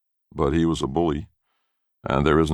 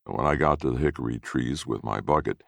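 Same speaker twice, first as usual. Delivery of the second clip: ordinary delivery, nothing marked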